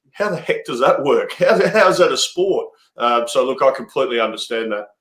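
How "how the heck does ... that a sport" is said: mock-baffled, pitch lifting on the second question; baffled outsider. Cricketer voicing baffled newcomers to the game, mock bewilderment. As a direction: puzzle aloud like a bewildered newcomer. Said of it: "most people would just go".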